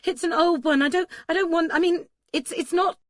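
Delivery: high, loud, halting excuses; flustered stammer. A narrator's reading. Bather fending off Duncan's medical look, high flustered stammer. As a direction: scramble for an excuse, stumbling and pitched high.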